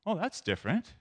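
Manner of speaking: high "Oh, that's different" with rising end; pleasantly intrigued. Shown as a demonstration. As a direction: lift "different" upward, as if struck by a new idea. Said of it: "Oh, I wouldn't have thought of that".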